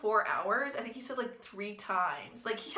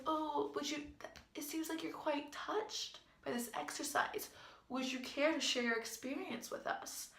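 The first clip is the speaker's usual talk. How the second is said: soft, high, hushed and coaxing; imitating a gentle coach; 6 semitones higher, 8 dB quieter than usual. Coach to a crying student, a soft coaxing invitation. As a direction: coax her kindly like a therapist.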